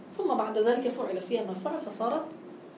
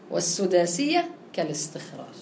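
ordinary talk, nothing marked

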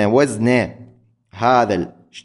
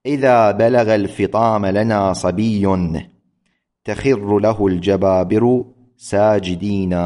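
poem recitation; 3 semitones lower than usual. Shown as a demonstration. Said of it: "قال".

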